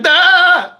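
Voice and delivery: high, drawn-out silly cry; odd falling yelp. Retold or imitated. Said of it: "why I made that sound".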